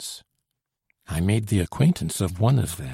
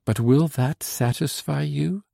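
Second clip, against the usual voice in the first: lightly pleading, high rising question; gently implore, lifting to the end; a touch of entreaty; beseeching; 6 semitones higher than usual. A narrator's reading. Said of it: "looked at me beseechingly and said".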